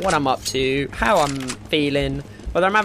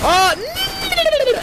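very high cry of defeat; dismayed squeal. Real, not acted. Gamer killed by his own Negev, dismayed squeal.

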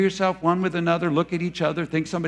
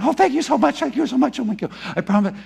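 much higher gushing relief; gushing thanks; 7 semitones higher, faster than usual. Retold or imitated. Sinner gushing thanks after release, high and breathless. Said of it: "we're going".

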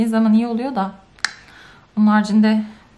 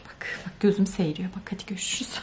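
hushed whispered sign-off; 7 dB quieter than usual. Real, not acted. Vlogger signing off from anger, in a whisper. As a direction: whisper the goodbye.

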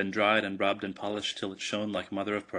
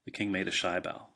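ordinary delivery

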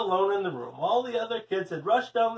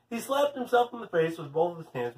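plain reading voice

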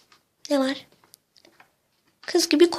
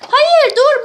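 high, loud protest; panicked squabbling shout; urgent objection; 9 semitones higher, 6 dB louder than usual. Acted. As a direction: cry out to stop them. Fighting over hiding place.

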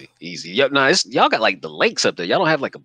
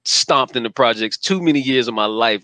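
ordinary talk, nothing marked

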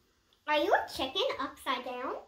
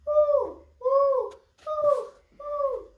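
high, loud owl hooting; 9 semitones higher, 6 dB louder, much slower than usual. Shown as a demonstration. Reading to a child, hooting like an owl. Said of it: "you can sound like an owl".

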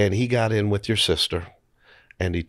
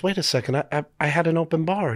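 retold mother, pitched higher, puzzled; mother's protest; 8 semitones higher, faster than usual. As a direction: voice mom as she protests the tab. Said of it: "your mom goes".

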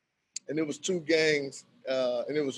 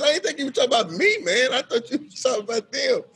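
higher, louder, fast, laughing; laughing protest; 8 semitones higher, 5 dB louder, faster than usual. Retold or imitated. Narrator explaining to Eric, laughing. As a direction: protest innocence through laughter. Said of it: "I said".

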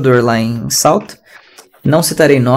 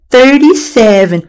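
slow, higher mock American accent; affected American English; 7 semitones higher, 3 dB louder, much slower than usual. Teacher posing as super American, mocking affected accent. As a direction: show off with drawn-out, over-Americanized English. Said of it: "falar só uns negócios bem imbecil".

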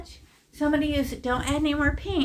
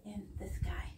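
breathy whispered aside; 5 semitones lower, 11 dB quieter than usual. Real, not acted. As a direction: drop to a whisper while dabbing. Painting demo, muttered aside.

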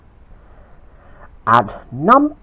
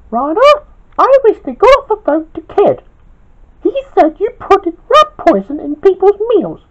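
much higher, louder boss scolding; stern shout, lightly done; 6 dB louder than usual. Acted. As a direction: tell him off as the boss. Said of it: "shouted Queenie".

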